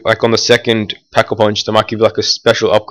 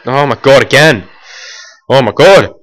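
louder, exasperated cry at the game; exasperated outburst; 3 semitones higher, 5 dB louder than usual. Real, not acted. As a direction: vent the frustration.

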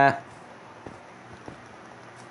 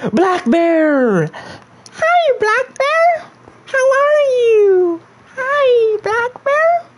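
very high put-on squeaky voice; silly falsetto greeting; much slower than usual. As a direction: greet them in a playful, drawn-out falsetto. Streamer greets viewer in put-on voice.

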